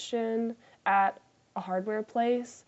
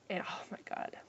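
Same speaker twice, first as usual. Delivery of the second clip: softer, whispered and overcome; emotional whisper; 11 dB quieter, faster than usual. Real, not acted. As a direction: whisper it, choked up with fondness. Getting emotional about sloths.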